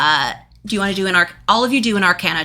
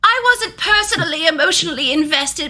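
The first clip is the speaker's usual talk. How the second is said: servant voice; 8 semitones higher than usual. Acted.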